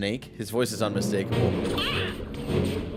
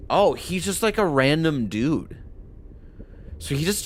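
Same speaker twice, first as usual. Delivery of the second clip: ordinary talk, nothing marked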